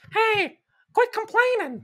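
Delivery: very high, slow squeaky cartoon voice; Muggsy voice. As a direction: pitch way up and drag it out like a tiny grumpy guy. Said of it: "that's the Muggsy voice".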